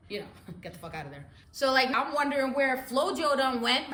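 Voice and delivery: usual speaking voice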